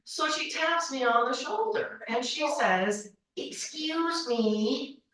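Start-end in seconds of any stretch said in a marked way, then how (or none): 3.4-5.0 very slow, softer, drawn-out stranger's voice; a hint of the pushy seatmate, dragged out; imitating the woman. Woman taps her shoulder.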